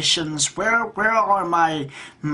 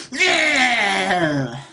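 drawn-out, higher mock cry; mocked elites; 4 semitones higher than usual. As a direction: imitate elites with one long jeering yell. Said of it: "the elites stand up and go".

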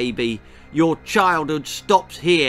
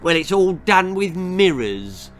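snooty, louder know-it-all sister; snotty; 5 semitones higher, 5 dB louder than usual. A narrator's reading. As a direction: talk down to him snottily. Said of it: "she said snottily".

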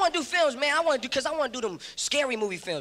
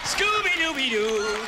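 goofy slow sing-song; much slower than usual. Retold or imitated.

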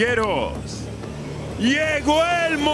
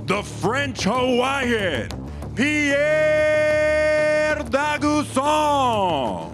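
long drawn-out shouted name, higher; lucha libre announcer; 10 semitones higher, much slower than usual. Acted. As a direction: shout the fighter's name, stretched out. Announcer calls fighter's name.

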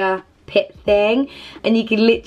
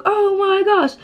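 much higher, breathy amazement; awestruck gasp; 10 semitones higher, slower than usual. Retold or imitated. Seeing coloured flames in the campfire, awestruck high gasp. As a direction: gasp at a miracle.

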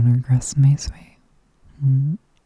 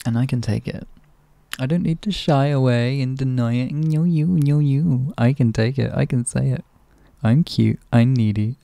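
ordinary talk

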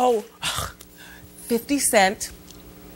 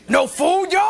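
mock angry boyfriend; 6 semitones higher than usual. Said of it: "you could see him coming in the house".

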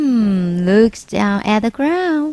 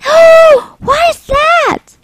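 high, loud gasping alarm; boy spotting another shark in the park; shocked gasp; 7 dB louder, slower than usual. Acted. Boy spots shark again.

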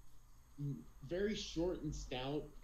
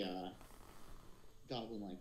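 usual speaking voice

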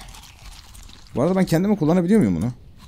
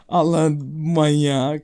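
laughing drawl; 2 semitones higher, much slower than usual. Real, not acted. Laughing at silly creature.

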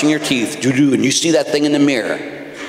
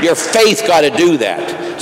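ordinary delivery

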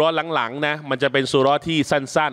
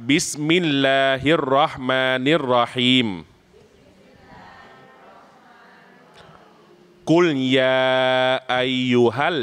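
melodic Quran recitation. Shown as a demonstration. Imam leading students in reciting surah al-Ikhlas, melodic chant. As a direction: chant each verse with a sung, stretched line.

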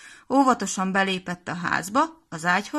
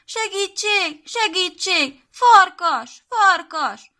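very high, loud, slow screams; desperate cries for help; 11 semitones higher, 8 dB louder, much slower than usual. Acted. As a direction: scream for help as the wolf chases. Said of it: "kétségbeesve kiabált".

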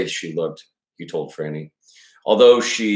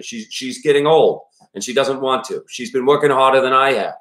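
put-on worried father in a higher-pitched Maine accent; 4 semitones higher than usual. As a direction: pour it out as a Maine dad, pitched up. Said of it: "when I do this accent".

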